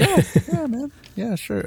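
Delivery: very high-pitched, over-eager yeah; mock people-pleasing agreement; awkward agreement. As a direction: play along awkwardly, pitch jumping up. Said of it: "Yeah, you're awkward".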